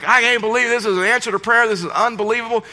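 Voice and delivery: high, gushing acted amazement; overjoyed disbelief. [name] celebrating an answer to prayer, overjoyed. [name] exclaims over the upgrade.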